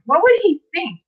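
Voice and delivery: real tears starting; tearful. Real, not acted. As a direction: ask it as the pain hits. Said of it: "I'm starting to cry a little bit".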